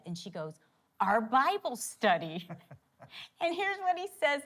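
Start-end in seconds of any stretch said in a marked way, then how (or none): 1.0-2.5 slow, louder reveal of BIBLE STUDY; "Bible study" announced loud and drawn out; proud punch line. Retold or imitated. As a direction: stretch out "Our Bible study" with emphasis. Delivers the story's punch line.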